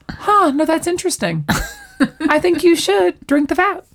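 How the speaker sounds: high-pitched imitation of pointed interest; mock intrigued. Rachel considers making Ross drink the fat, mock intrigued. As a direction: play at musing over a wicked idea.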